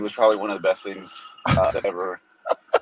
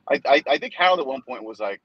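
ordinary talk, nothing marked

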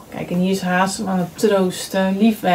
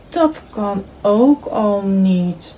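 slow, dejected sigh of a line; unhappy; slower than usual. A narrator's reading. Frog after burning his cake, slow and unhappy. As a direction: drag it out, let him sink. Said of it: "dacht hij ongelukkig".

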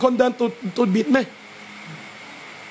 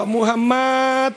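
good-mood call. Shown as a demonstration. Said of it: "อารมณ์ดีๆก็".

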